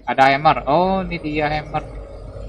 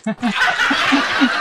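loud burst of laughter; 12 semitones higher, 3 dB louder than usual. Real, not acted. Character breaks leg jumping.

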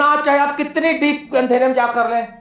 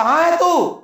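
calling out; 7 semitones higher, slower than usual. Acted. God calls out to Adam.

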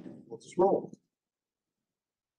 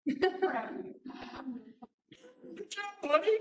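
put-on much higher, strained cheery praise to a child; forced praise; 13 semitones higher than usual. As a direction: hide your horror behind praise. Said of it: "And I was just like".

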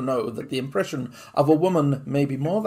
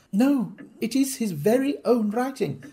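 higher, slow insistent wife; lady insisting; 10 semitones higher than usual. Acted. Wife insisting to Holmes, higher and slow. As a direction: lean on her certainty.

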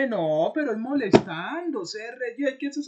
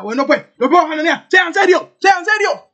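furious yelling, much louder and higher; angry shout; 8 semitones higher, 16 dB louder than usual. Retold or imitated. Streamer yelling at teasing chat, furious shout. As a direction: bark at the chat to get serious. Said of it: "le diga".

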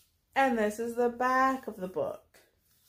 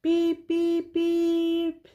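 high horn beeps, last one held; little truck beep; 7 semitones higher, 4 dB louder, much slower than usual. A narrator's reading. Blue rolls away with friends, high beeping.

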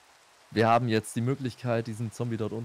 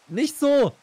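hoch, laut und ungeduldig; genervtes Rufen; 9 semitones higher, 5 dB louder, slower than usual. Real, not acted. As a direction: schimpf ihn laut zurecht. Genervt, Partner baut falsch. (genervt rufend)

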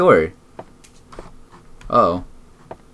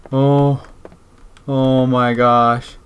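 normal talking voice

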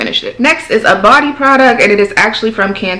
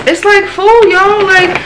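pitched way up, amazed at the full bag; excited exclaim; 10 semitones higher, slower than usual. Real, not acted. Vlogger to viewers about her overflowing empties bag, excited high exclaim. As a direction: squeal it out, half disbelieving.

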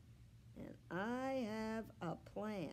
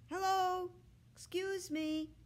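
higher, louder hail across the water; shouting; 11 semitones higher, 4 dB louder than usual. A narrator's reading. Kayla hailing a fisherman on the lake, shouted call. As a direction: call out to get his attention. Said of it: "Kayla shouts to a fisherman".

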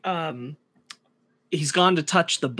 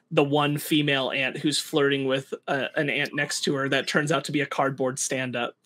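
ordinary talk, nothing marked

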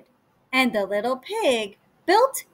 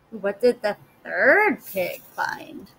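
ordinary delivery

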